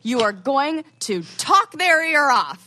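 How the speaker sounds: mom's rousing pep talk, high and loud; retold pep talk. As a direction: imitate a mom firing up her kid. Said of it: "I call my mom, and my mom".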